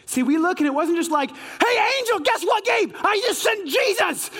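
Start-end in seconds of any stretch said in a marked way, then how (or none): 1.3-4.3 high-pitched, bursting with news; hammed-up thrill, calling out to an angel; excited. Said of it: "I get excited".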